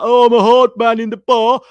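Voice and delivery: loud, high mock hard-man Scouse bloke; hammed-up Carragher voice. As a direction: bray it like a pub hard man. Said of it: "Carragher is just like".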